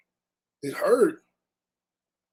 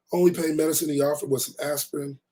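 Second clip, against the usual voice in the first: ordinary delivery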